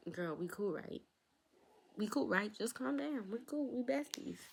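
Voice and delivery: high, pushy imitation of Mia; high mock-breezy besties voice; mock sassy friend. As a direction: play Mia, insisting you're cool. Quoting Mia brushing it off.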